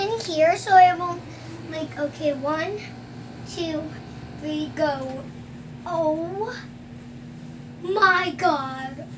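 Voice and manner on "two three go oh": usual speaking voice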